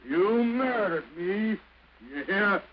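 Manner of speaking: slow, high, loud cry of hurt; anguished accusation. Acted. Husband accusing his unfaithful wife, anguished cry. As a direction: fling the words at her in anguish.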